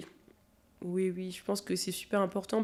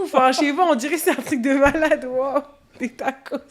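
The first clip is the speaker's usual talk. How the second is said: rire moqueur, plus fort; moqueuse en riant; 5 semitones higher, 10 dB louder than usual. Real, not acted. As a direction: taquiner en riant. Se moque de l'enthousiasme. (moquerie amusée)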